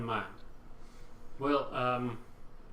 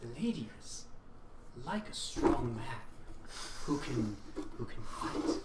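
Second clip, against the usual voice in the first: sly; 4 dB quieter than usual. Acted. Barkeep shares a sly secret.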